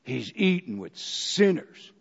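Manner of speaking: low, soft grumble under the breath; grumbling crowd. Retold or imitated. Crowd grumbling about Jesus eating with sinners, low and soft. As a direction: mutter it like a scandalized bystander. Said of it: "They grumbled".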